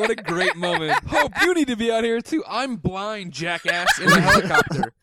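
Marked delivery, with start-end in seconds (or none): 1.1-2.4 high, loud call out; voicing Michael; calling to Hope. Acting a scene line. 2.5-4.9 loud high squeal through laughter; mock Hope's retort laughing.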